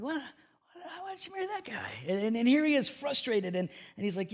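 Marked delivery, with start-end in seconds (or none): 0.0-1.9 high, soft mock bewilderment; baffled complaint. Preacher imitating frustrated bachelor puzzled by women's choices, baffled complaint. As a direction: squeak out a puzzled mock protest.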